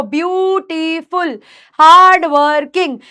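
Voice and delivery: ब्यूटीफुल and हार्ड वर्किंग stretched syllable by syllable; syllables broken apart. Shown as a demonstration. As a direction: slowly split ब्यू-टी-फुल, हार्ड-वर-किंग. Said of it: "ये टूट रहे हैं sounds के अंदर".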